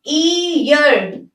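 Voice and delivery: ईयर stretched very slowly into "ee-yar"; demonstrating pronunciation. Shown as a demonstration. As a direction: draw out each sound of ईयर for learners. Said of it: "इसकी क्या होती है?". (ईयर का धीमा, खींचा हुआ उच्चारण)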